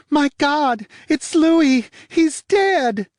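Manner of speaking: high, loud, frantic woman's voice; woman's panicked cry. Acted. Woman finding the dead man, high panicked cry. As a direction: shriek it out in horror. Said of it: "The woman's voice said".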